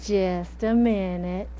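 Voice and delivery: slow, soft, low Southern mom; unhurried Southern drawl; mother's Southern drawl. Retold or imitated. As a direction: imitate a Southern mother, stretching each word. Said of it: "She's very Southern".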